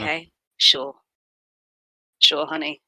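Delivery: low, dry, unimpressed; dry sarcastic. Acted. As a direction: brush it off flatly. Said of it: "Clem just folds their arms like".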